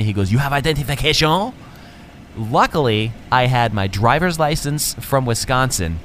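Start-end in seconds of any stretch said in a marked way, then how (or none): 0.3-1.5 mock French officer questioning; exaggerated French-accented demand. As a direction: ask it in a put-on French accent. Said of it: "little French accent".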